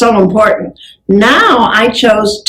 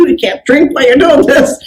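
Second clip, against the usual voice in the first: 1 laughing; 6 semitones higher than usual. Real, not acted. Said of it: "laughing aside".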